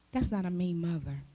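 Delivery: ordinary talk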